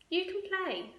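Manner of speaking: mother. Acted. Mother answers children.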